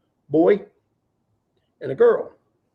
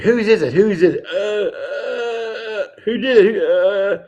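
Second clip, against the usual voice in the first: mock shrill demand, louder and higher; hammed-up jealous girl grilling her date, laughing through it; a girl catching her boyfriend out, half-laughing accusation; accusing girlfriend; 6 semitones higher, 4 dB louder, slower than usual. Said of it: "And she's like".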